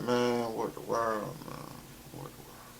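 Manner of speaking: soft, fading to almost nothing; reenacted hushed disbelief; stunned muttering. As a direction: mutter to yourself, trailing away. Reenacts reaction to pregnancy news.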